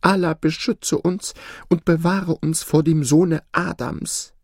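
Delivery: high-pitched anxious plea; fearful goose pleading. Acted. Goose begging Allah for protection from man, fearful.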